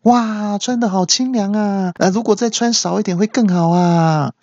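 high, lecherous mock ogling; leering passerby. Ordinary men passing betel nut stand, leering high voice. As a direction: ogle like a lecherous guy. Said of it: "一般人经过的话都会说".

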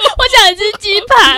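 high, slow whining plea; mock whining. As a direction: whine like a homesick kid. Joining the mock whine.

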